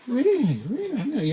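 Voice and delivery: high-pitched, imitated astonishment; mock amazement. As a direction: act out a wide-eyed tourist, voice shooting up. Acts out passengers' amazed reply.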